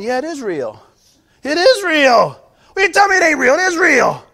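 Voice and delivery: starts firm, grows into a loud insistent shout; building insistent shout. Retold or imitated. Preacher shouting back at a doubter, rising to a shout.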